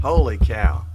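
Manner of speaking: loud startled outburst; surprised loud exclamation. Real, not acted. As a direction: blurt it out, genuinely startled. Reacts to loud mic sound.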